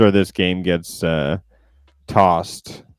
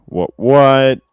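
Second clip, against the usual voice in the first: sudden loud, high double take; surprised; 6 semitones higher, 10 dB louder than usual. Real, not acted. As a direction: blurt it out in surprise. Mock surprise at own joke.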